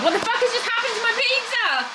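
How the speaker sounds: horrified at the ruined pizza; shocked. Real, not acted.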